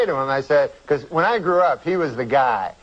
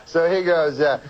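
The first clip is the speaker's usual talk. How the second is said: ordinary talk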